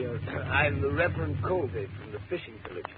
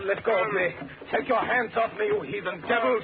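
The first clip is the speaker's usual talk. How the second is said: higher, straining protest while grabbed; angry struggle; 9 semitones higher than usual. Acted. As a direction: fight them off, outraged. Struggling against the servants.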